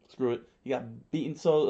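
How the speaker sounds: usual speaking voice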